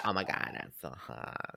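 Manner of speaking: low, soft imitated fry; mock LA vocal fry. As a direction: let it creak low and quiet. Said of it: "what a vocal fry is".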